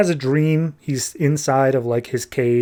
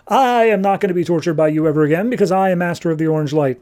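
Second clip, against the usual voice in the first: higher, quick retold proclamation; defiant declaration; 4 semitones higher, faster than usual. Prisoner refuses more torture, defiant declaration. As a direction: lightly act a defiant boast, pitched up.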